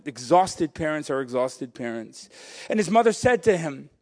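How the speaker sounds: ordinary talk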